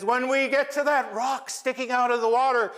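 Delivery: high coaxing plea; sweet-talking Raven. Acted. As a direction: wheedle the crab into letting go. Raven sweet-talks Crab.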